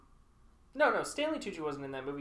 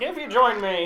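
higher, louder mock villain line; mocking a given-up impression; 4 semitones higher, 7 dB louder than usual. Co-host mimics the failed Megatron, higher mocking voice. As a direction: mimic a half-hearted villain impression, raised and loud. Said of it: "you just gave up and you're just like".

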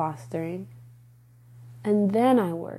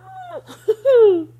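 high crying laugh; 9 dB louder than usual. Real, not acted. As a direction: laugh breaking into tears. Emotional laugh after talking life.

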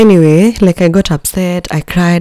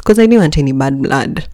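ordinary talk, nothing marked

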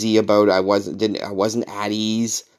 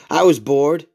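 a hint of old neighbor, pitched up; lightly voiced old Frenchman; 6 semitones higher, 4 dB louder than usual. Old French neighbor explaining why he mowed, lightly imitated. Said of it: "this really nice old French guy".

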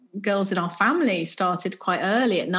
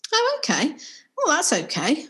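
put-on higher, breezy unbothered child; lightly voiced young girl; 7 semitones higher than usual. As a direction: shrug it off like a nine-year-old. Daughter's breezy reply.

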